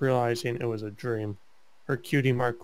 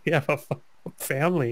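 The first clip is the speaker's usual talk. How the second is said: laughing; 4 semitones higher, 4 dB louder, much faster than usual. Real, not acted. Giggles mid fanfic reading.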